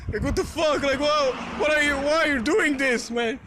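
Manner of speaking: a touch of hammed-up outrage; incredulous. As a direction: act out shocked confusion. Retold confrontation with shooter.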